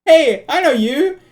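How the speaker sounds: high, loud, startled character voice; flustered game character. Acted. Man recognized someone and has to run, flustered and loud. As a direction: blurt it out and make a quick escape.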